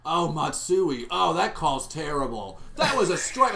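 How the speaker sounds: loud, drawn-out hammed-up fan reactions; mock fan outbursts. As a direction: imitate an overwrought fan reacting to every play. Mocks Yankee fan friend's running commentary.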